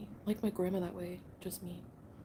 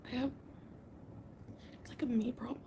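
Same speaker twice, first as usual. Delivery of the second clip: whisper; 3 semitones higher than usual. Real, not acted. Admitting to viewers it's her own problem, whispered. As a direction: murmur it to yourself. Late night, husband asleep nearby.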